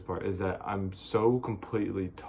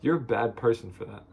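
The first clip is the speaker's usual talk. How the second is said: usual speaking voice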